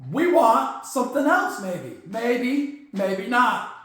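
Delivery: usual speaking voice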